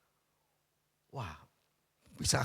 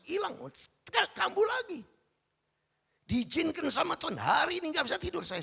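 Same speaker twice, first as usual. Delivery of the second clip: much higher and louder, impassioned sermon; preaching at full force; 9 dB louder than usual. Acted. Pastor proclaiming his trials to the congregation, impassioned and loud. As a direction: pour it out like a revival preacher, crying out.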